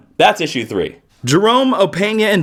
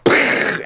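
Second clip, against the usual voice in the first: unvoiced lip puff for a blast; pfft explosion noise. City blowing up, mock pfft explosion sound. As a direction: puff air through the lips like a tiny explosion. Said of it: "you see New York go".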